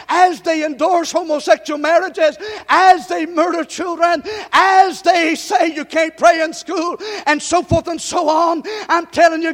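loud, climbing sermon cadence, hammering each line; preaching at full force. Acted. Pastor rousing the church, preaching at full force. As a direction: drive it home to the congregation.

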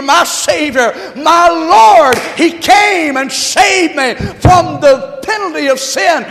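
loud, high climactic praise; preacher shouting praise to close the sermon; full-force preaching. Acted.

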